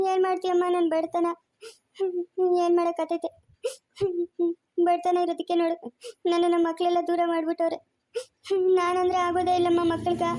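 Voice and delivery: sobbing between phrases; tearful lament. Acted. Poor father crying to a woman about his estranged children, tearful. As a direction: lament through tears, pausing to sob. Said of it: "ಅಳ್ಬೇಡ ಸುಮ್ನಿರಪ್ಪ".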